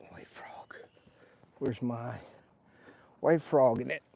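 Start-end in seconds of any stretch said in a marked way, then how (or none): 0.1-0.8 unvoiced whisper to himself. Real, not acted. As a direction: whisper it under your breath. Murmured aside while tying lure.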